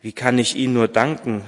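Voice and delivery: usual speaking voice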